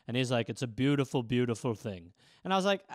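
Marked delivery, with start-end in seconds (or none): 0.5-2.1 a hint of low, earnest father; lightly voiced dad, lower; gentle dad. Dad explaining to his son, low.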